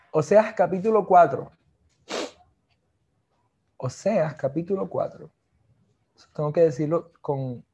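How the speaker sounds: ordinary talk, nothing marked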